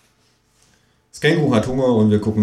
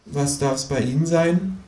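a bit higher, slow routine question; lightly voiced cashier; 6 semitones higher, 3 dB quieter, slower than usual. Cashier turns to the next customer. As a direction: ask the next customer as if nothing happened. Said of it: "fragt einfach den Nächsten".

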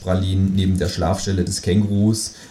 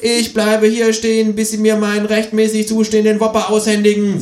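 stubborn kangaroo; 13 semitones higher, 4 dB louder than usual. Acted. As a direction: plant your feet and refuse. Kangaroo insists.

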